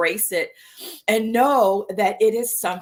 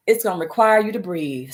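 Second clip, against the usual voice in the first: ordinary talk, nothing marked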